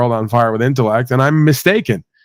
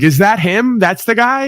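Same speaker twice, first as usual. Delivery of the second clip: higher, lightly voiced imitation of his wife; a hint of a nosy wife, pitched up; mock wife voice; 9 semitones higher than usual. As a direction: toss it up high like a gossip. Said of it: "She's like".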